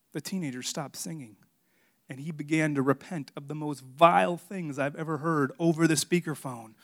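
ordinary delivery

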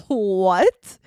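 loud startled what; shocked disbelief. Retold or imitated. Told about [name]'s New Year's party, she blurts out in loud surprise. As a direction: blurt it out, caught off guard.

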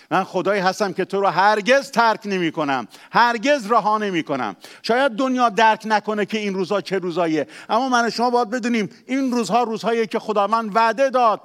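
loud, high preaching climax; fervent loud sermon peak; full-force preaching. Acted. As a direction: preach it out with all your strength.

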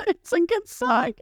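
high giggling aside; laughing squeal. Real, not acted. Admitting she loves the song, giggling high.

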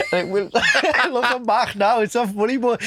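high-pitched words through laughter; real giggling. Real, not acted. Looking back on a classroom story, laughing helplessly. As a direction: try to talk while cracking up. Cracks up at his own story.

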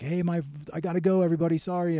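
high, loud apologetic call while cutting the line; urgent call to crowd. Retold or imitated. Cutting through security, calling to everyone, high and urgent. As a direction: shout an apology as you push past. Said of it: "I was like".

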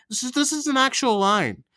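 ordinary delivery

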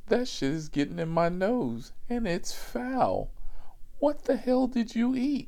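high, rising disgusted rant, loudest at the end; disgusted passenger. Retold or imitated. Guy complaining to the back-seat lady about the smell, high mock outrage. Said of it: "And he's like".

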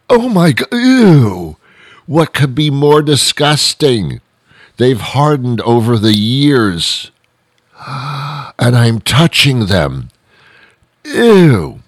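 high-pitched, hammed-up disgust; exaggerated comic revulsion, drawn out; grossed out. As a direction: recoil in horror, squealing the ews. Relives disgust at touching dried boogers.

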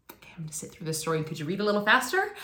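fast, hushed, hurrying; impatient kid inner voice. Retold or imitated. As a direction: rush it like a restless child. Kid impatient during Christmas story.

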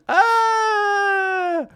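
long, high sustained yell; shout. Real, not acted. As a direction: let out a drawn-out high cry. Lone shout after the credits.

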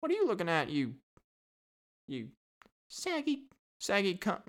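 high sneering bird voice; British parrot insult. Pretend. Parrot curses out a visitor, high sneering squawk. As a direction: jeer at the visitor like a rude parrot. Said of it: "they were probably just like".

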